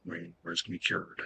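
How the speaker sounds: normal talking voice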